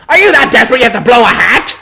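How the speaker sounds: high, loud jeering schoolboy; mock her in front of the whole hall; loud high-pitched jeer at Hermione; sneering taunt. A narrator's reading. Malfoy taunts Hermione publicly.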